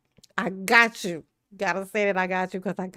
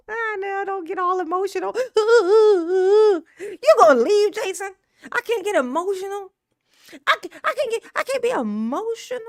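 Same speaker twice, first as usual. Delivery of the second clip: mock weepy; 9 semitones higher, 4 dB louder than usual. Mock crying over Jason leaving.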